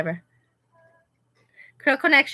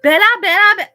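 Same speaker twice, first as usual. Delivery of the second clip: excited call; 12 semitones higher, 12 dB louder, much faster than usual. Pretend. Calling out to viewer Bella.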